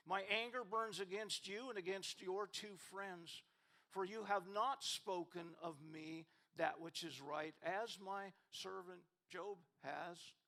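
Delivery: loud stern rebuke read aloud; preaching at full force. Acted. Pastor reads God rebuking Eliphaz, stern preaching. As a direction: proclaim God's anger from the pulpit.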